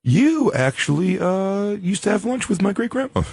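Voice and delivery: high, hesitant fan approaching a star; tentative high-voiced claim of a connection; awkward fan. Acting a fan approaching [name].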